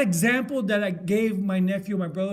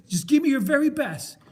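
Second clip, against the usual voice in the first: raised, higher-pitched football coach demand; coach's challenge; 5 semitones higher, 6 dB louder than usual. Retold or imitated. Film coach retold, challenging his player loudly.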